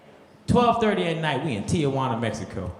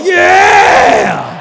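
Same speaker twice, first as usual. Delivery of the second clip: full-throated high party yell; wild whoop; 12 semitones higher, 15 dB louder than usual. Retold or imitated. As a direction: whoop it like a frat boy.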